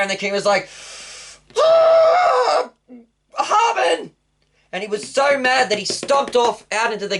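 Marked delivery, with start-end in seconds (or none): 1.6-4.1 very high, loud enraged cry of the name; furious shout. Acted. King to Haman, caught out, a furious high yell. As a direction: explode at him, a shrieking roar of rage.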